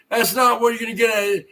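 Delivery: normal talking voice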